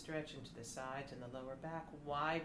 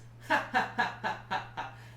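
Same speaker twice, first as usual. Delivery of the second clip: unvoiced laugh from the ribs; breathy laugh; 7 semitones higher, 9 dB louder than usual. Shown as a demonstration. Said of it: "On the ribs".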